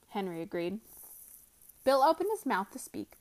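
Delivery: ordinary delivery, nothing marked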